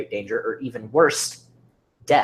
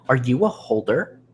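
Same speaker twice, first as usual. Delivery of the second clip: firm, louder challenge; bold, forceful questioning; 5 dB louder than usual. A narrator's reading. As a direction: put the question firmly, without fear. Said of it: "in a firm voice".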